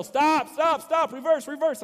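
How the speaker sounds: high, loud, urgent repeated yell; yelling stop. Retold or imitated. Intern behind the van yells at driver again, high loud yell. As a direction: shout a warning as if the roof is about to scrape. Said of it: "he starts to yell".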